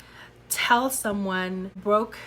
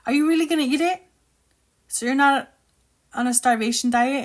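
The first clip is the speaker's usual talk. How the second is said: challenging question; 4 semitones higher, 7 dB louder, faster than usual. Retold or imitated. Voicing friend challenging her over candy.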